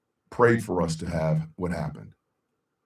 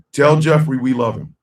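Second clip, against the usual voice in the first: calling out; 5 semitones higher, 9 dB louder than usual. Real, not acted. Calling out to son.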